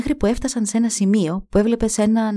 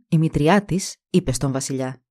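ordinary delivery

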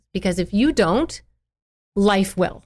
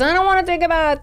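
put-on whiny child; 10 semitones higher than usual. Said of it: "your child says".

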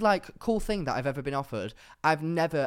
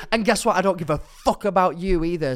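retold shout; 6 dB louder than usual. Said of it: "so then I was like".

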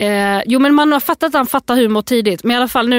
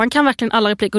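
ordinary talk, nothing marked

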